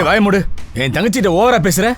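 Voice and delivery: angry warning. Acted. Brother defends sister in quarrel.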